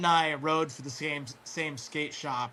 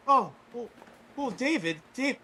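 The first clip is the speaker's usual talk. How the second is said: halting, higher-pitched dad impression; imitated friend's dad; 8 semitones higher than usual. Imitating the dad picking up David's call, halting high voice. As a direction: fumble for words as a taken-aback dad. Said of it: "and his dad is just like".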